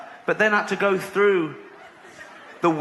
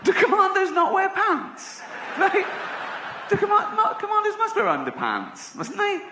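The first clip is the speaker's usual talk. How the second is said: incredulous; 14 semitones higher than usual. Acted. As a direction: puzzle it out in a much higher voice. Incredulous musing about commandos.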